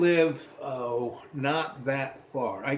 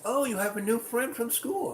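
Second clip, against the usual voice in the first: sweet mom voice; 9 semitones higher than usual. Retold or imitated. Mom greets son's friend.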